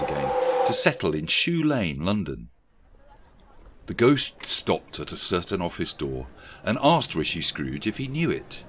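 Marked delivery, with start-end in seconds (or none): none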